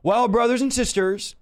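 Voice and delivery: ordinary talk, nothing marked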